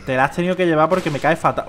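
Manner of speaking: usual speaking voice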